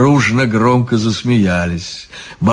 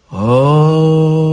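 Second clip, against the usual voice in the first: long drawn-out "aah" of surprise, rising; surprised chorus; 6 semitones higher than usual. A narrator's reading. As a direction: let a higher, sustained "ah" of realization swell. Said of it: "удивились все в один голос". (удивлённое протяжное «а-а-а»)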